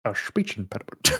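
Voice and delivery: "speech impediment" said softly with SH for S; quietly slush the S in "speech"; mock lisp. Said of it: "I don't think you had a lisp before".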